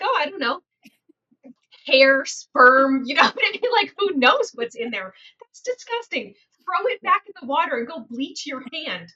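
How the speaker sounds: high-pitched retold disgust; grossed out. Realtor scolding client over jet tub glob, disgusted. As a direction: recoil in mock horror at the mess.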